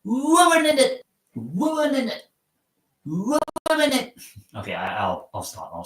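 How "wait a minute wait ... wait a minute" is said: high mock British "wait a minute" with the t dropped; mock British accent. Shown as a demonstration. Streamer mocking British slang, high mock British accent. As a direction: pitch it up like a Brit. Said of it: "me saying".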